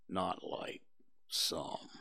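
low, slow, whispered and mournful; gloomy donkey Eeyore. Acted. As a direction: sigh it out like a sad old donkey.

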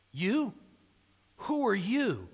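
incredulous challenge leaning hard on "you"; stress on YOU. Shown as a demonstration. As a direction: hit "you" like an accusation. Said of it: "see, the emphasis, um, there is on the word you".

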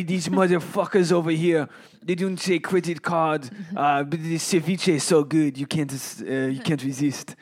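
imitated eccentric Frenchwoman, thick French accent; hammed-up French accent, cursing then raving; French lady accent. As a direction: put on a heavy French accent. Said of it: "that was a good accent".

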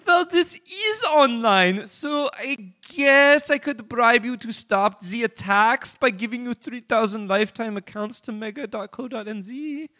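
put-on high, loud German accent. Kim Dot Com answering the hackers in a German accent. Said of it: "a German accent".

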